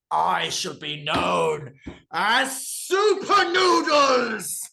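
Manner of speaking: very high, slow cartoon voice building to a shout; a noodle packet transforming into a hero; noodle superhero. Ramen packet gaining powers after a lab accident, high and building to a shout.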